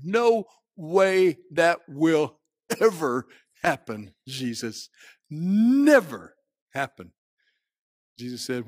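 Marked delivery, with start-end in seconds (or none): 0.0-7.1 slow, word-by-word refusal; emphatic vow. Acted. Peter telling Jesus he will never deny him, emphatic vow. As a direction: swear it with heavy, drawn-out stress.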